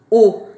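teacher calling out the U sound loudly; loud sound demo. Shown as a demonstration. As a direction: punch out the U sound. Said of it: "o R ... tem som de".

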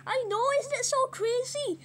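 high-pitched little pig; mini pig voice. Acted. Small pig remarks on its new voice, high and small. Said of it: "you finally got your mini pig voice".

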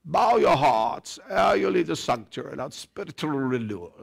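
mock-solemn stage voice, fading away at the end; theatrical thespian. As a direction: intone it like a school-play churchman. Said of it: "Class Thespian, nineteen seventy-four".

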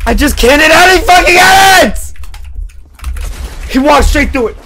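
furious gamer shout; raging gamer. Real, not acted. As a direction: scream at the game in rage.

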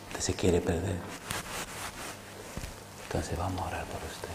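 a low, private pastoral rebuke; hushed rebuke. Retold or imitated. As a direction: rebuke him quietly in private.

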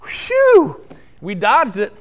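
loud, high burst of relief; relieved whew. Retold or imitated. Boys escaping the bull, loud relieved whew. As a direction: blow out the whew like you just escaped. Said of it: "and they thought".

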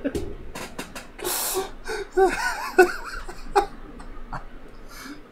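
long, high, wheezy laughing fit; real uncontrolled laughter, trailing down. Real, not acted. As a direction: laugh helplessly until out of breath. Cracking up over funny clip.

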